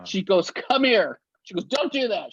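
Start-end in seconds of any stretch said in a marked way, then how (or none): 0.5-1.2 higher, louder sharp call; retold yell. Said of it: "She yells at him". 1.6-2.3 higher, scolding call; retold scolding yell. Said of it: "She yells at him".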